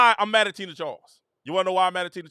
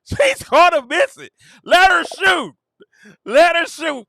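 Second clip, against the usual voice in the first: loud, higher yell at the game; shouting at the TV; 6 semitones higher, 6 dB louder, slower than usual. Real, not acted. Streamer yelling at a player on screen, loud and high. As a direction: yell it at the screen like the coach can hear.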